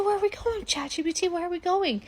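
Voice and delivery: high, breathless curiosity; eager high question. Acted. Crystal eagerly asking ChatGPT where they're going.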